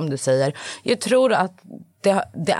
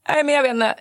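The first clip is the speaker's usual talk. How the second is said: put-on a higher, small boy's voice; mock child voice, much higher; imitating young son; 10 semitones higher than usual. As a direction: play the little son, unsure and high. Mother quoting her crying son.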